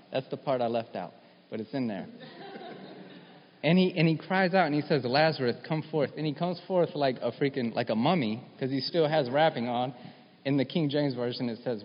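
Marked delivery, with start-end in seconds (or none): 3.6-7.9 high, loud, rising preacher's fervour; preaching at full force. Acted. Preacher urging his congregation that this is real, fired up. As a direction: preach it out, pushing toward the congregation. Preacher cries out Lazarus story.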